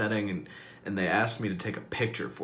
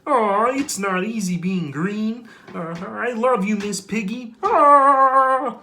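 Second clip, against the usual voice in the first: high, loud, slow frog puppet voice; nasal-free high puppet frog voice; Kermit the Frog; 13 semitones higher, 12 dB louder, much slower than usual. Retold or imitated. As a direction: Kermit pining over Miss Piggy. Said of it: "Kermit the Frog".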